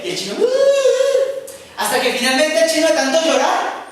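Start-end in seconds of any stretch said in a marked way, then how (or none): 0.4-1.5 lloriqueo agudo y lento de niño; llanto fingido de niño. Acted. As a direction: imita el berrinche de un niño. El niño llorando. (niño berreando con voz aguda)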